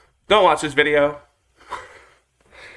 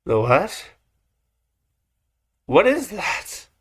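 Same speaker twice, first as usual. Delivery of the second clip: startled question; 5 semitones higher than usual. Real, not acted. Shocked by the word.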